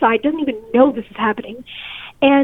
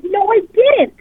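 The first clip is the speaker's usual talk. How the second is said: higher-pitched retold protest; indignant denial; 7 semitones higher, 4 dB louder than usual. Denying to the officer that she refused, indignant. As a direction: push back, pitch jumping up.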